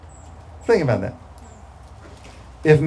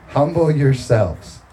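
ordinary talk

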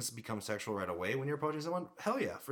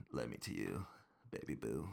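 tender; 8 semitones lower, 7 dB quieter than usual. Real, not acted. Compliments girlfriend mid-podcast.

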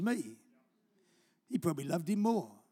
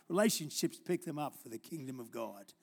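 ordinary delivery

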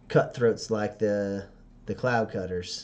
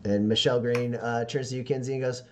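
ordinary talk, nothing marked

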